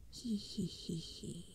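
slow, dry throaty creature cackle; evil dry cackle. Acted. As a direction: cough out an evil dry laugh. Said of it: "An ugly laugh ... an evil, dry sound".

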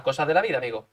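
ordinary talk

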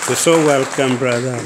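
booming welcome called across the room; big hearty shout of welcome; loud welcome. Real, not acted. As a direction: call him up warmly and loud. Welcoming the speaker to the front.

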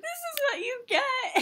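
laughing. Real, not acted.

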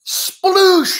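high, loud sploosh; splash effect. Acted. Storyteller bursting his hero out of water, sploosh effect. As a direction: shout it as a big splash.

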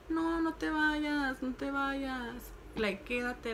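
high, drawn-out imitation of begging; mock pleading. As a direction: plead slowly with stretched vowels, imitating a clingy girlfriend. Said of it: "le gustaba que le rogaran".

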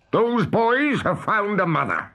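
high, slow captain's lament; Hook crying out. A narrator's reading. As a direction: cry the bad news as the captain. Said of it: "cried Hook".

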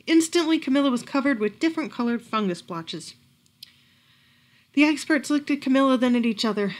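ordinary delivery

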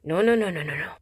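usual speaking voice

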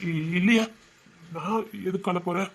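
holding back tears; tearful denial. Acted. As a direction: stammer slightly and deny you are crying. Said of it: "ஏங்க அலறீங்க?".

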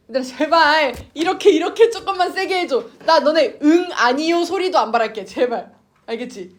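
exasperated, coaxing plea from a teacher; pleading. Real, not acted. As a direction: beg the students for any response. Teacher begs silent students to respond.

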